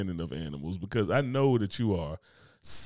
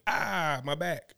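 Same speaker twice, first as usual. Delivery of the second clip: higher-pitched mock moose yelp; hurt moose; 6 semitones higher, 4 dB quieter, much slower than usual. Retelling the bear attack, mock-pained moose. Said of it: "And the moose went".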